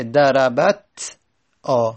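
"دربت" stopped on ت, pause, then a separate آ; unvowelled final ت. Shown as a demonstration. Teacher concluding the underlying form, split "دربت آ". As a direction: break "دربت آ" at the vowelless ت. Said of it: "اصلش ساکنه".

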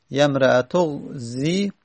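"یمرأتو غزی" slow, ending on long zii; unjoined ending. Shown as a demonstration. Teacher builds up the phrase, "یمرأتو غزی" slow with long final vowel. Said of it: "الان بحثمون".

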